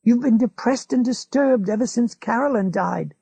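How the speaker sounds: a hint of an old man crying out; McGovern crying out. A narrator's reading. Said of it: "McGovern cried".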